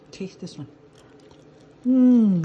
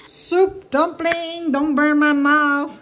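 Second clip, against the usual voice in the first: slow, high, and loud; drawn-out hopeful plea; 6 semitones higher, 7 dB louder, much slower than usual. Real, not acted. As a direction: stretch it out, bracing for heat. Bracing for hot dumpling.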